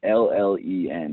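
letters spelt slowly one by one; slow careful spelling. Shown as a demonstration. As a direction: spell it out clearly for listeners. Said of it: "my handle is Totrillin".

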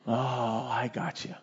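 soft, low "oh, I see"; hushed, drawn-back response after the outburst; understanding reply. Retold or imitated. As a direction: quietly reassure an upset child. Pastor's understanding reply to upset child.

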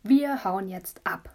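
slow, deliberate "Wir hauen jetzt ab"; slow firm declaration. Acted. Little brother agreeing to run away, slow and firm. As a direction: spell out the decision. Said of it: "hat Laurin gesagt".

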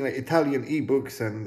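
plain reading voice

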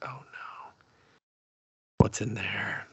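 very soft, hushed mock dread; dreading whisper. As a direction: whisper like a viewer fearing what's inside. Viewer dreading what is behind door.

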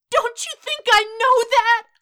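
high, loud wail choked with misery; miserable, warbling cry; desperate shout. A narrator's reading. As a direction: scream it out in anguish. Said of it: "Izuku's desperate shout, warbling and choked with misery".

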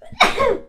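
a sudden real sneeze; sneezing. Real, not acted. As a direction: let a sneeze burst out. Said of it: "ich muss niesen". (lautes Niesen)

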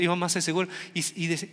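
usual speaking voice

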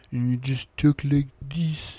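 slow mock French accent; French accent with pushed-out lips. Shown as a demonstration. Host trying co-host's trick, slow French accent. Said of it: "push out your lips".

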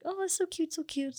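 high, soft cooing over a cute dog. Retold or imitated. Walker petting a neighbor's chihuahua, high soft cooing.

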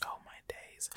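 urgent hushed whisper. Real, not acted. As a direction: whisper it to the viewers. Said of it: "You guys should go".

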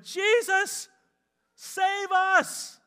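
high, loud panicked cry for help; desperate plea. Acted. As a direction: beg to be saved, yelling. Disciples cry to Jesus.

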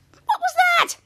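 very high-pitched astonished yell; shocked question. Retold or imitated. Captain Man questions Kid Danger's throw, high shocked squawk. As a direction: squawk it in disbelief.

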